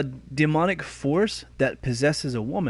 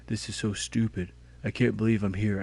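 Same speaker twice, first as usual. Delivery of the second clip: lower, softer, grumbling complaint; whiny hater; 5 semitones lower, 5 dB quieter than usual. Retold or imitated. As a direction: mumble it like a sulky viewer. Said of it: "go on a rant".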